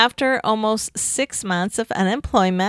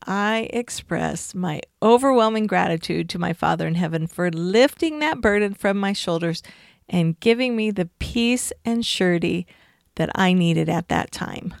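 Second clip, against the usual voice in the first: normal talking voice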